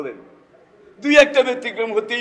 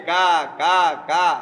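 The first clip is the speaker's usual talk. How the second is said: crow caw; slower than usual. Retold or imitated. Imitating a crow's call.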